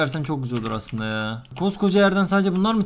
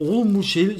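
usual speaking voice

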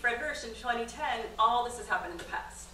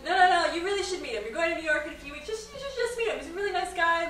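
higher, louder eager pleading; insistent husband; 9 semitones higher, 7 dB louder than usual. Retold or imitated. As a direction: push her to meet him, all eager. Husband insisting she meet him.

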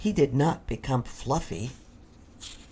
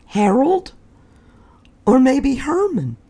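eager guesses; 11 semitones higher, 8 dB louder than usual. Acted. Fluffy guessing names.

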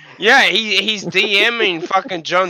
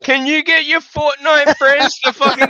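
raised voice, mock pleading; mock pleading dad; 5 semitones higher than usual. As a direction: imitate a desperate dad nagging his son. Acts out man begging his son.

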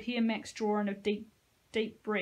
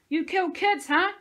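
screamed much higher and louder in rage; 9 semitones higher, 9 dB louder than usual. Acted. Josie screams into the soldier's face, enraged. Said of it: "screamed in his face".

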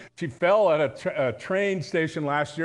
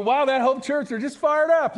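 high, bright gush of admiration; excited praise; 8 semitones higher, 4 dB louder than usual. Pretend. Visitors praising Hope Church, excitedly, loud and high. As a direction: gush like an impressed outsider.

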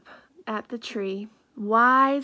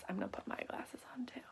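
whisper; 3 semitones lower, 16 dB quieter, much faster than usual. Real, not acted. Reader's aside while reading.

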